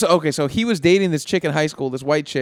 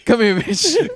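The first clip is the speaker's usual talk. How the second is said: higher monotone breaking into laughter; Tiger Woods impression; 7 semitones higher, 4 dB louder, slower than usual. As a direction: try the flat voice but laugh. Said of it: "That's my Tiger impression".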